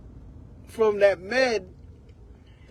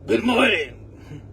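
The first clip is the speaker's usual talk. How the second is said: aggressive tone; slower than usual. Retold or imitated. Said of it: "That voice".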